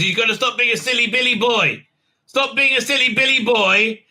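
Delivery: put-on high, sing-song scolding of a silly boy; mock scolding voice. Recapper mocking the captain scolding Booker. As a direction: tease him like a nagging parent.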